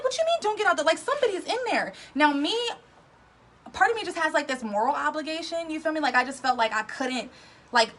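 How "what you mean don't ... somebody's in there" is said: incredulous protest. Retold or imitated. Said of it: "I'm like".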